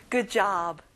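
normal talking voice

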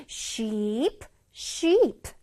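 "sheep" with a long drawn ee; long vowel. Shown as a demonstration. Teacher showing children "sheep" with a long ee sound. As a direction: hold the ee in "sheep". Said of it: "길게 발음해줘야 된다".

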